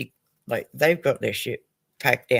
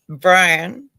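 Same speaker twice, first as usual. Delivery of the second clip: calling out; 6 semitones higher, 10 dB louder than usual. Real, not acted. Calling out to viewer Brian.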